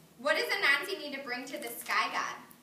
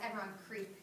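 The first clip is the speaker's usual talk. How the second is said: much softer creeping voice; quiet sneaking cue; hushed; 2 semitones lower, 11 dB quieter than usual. Acted.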